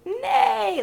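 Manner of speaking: high playful neigh; horse neigh. Pretend. Mother imitating horse for toddler.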